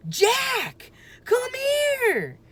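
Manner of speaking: high loud crying call for help; frightened call. A narrator's reading. Annie calling Jack for help, frightened. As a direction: cry out for him. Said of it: "Annie called".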